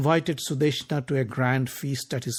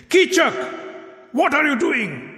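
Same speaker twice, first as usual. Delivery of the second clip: high, loud shocked cry; shocked shout; 12 semitones higher, 4 dB louder than usual. A narrator's reading.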